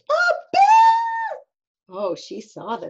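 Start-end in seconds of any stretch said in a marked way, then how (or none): 0.1-1.4 very high, loud scream of discovery; piercing, drawn-out storybook scream; shocked shriek. Acted. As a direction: shriek as if suddenly seeing a bear. Mom suddenly sees the bear.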